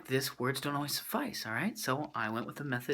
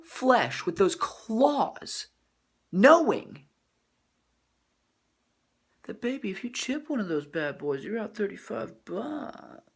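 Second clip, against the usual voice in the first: mock outrage; 9 semitones higher, 7 dB louder than usual. Retorting angrily to girlfriend.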